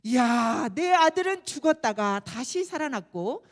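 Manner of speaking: loud, joyful proclamation; joyful father. Acted. Father rejoicing to all over his lost son, loud and joyful. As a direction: cry out your delight like a proud father. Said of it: "하면서 즐거워했어요".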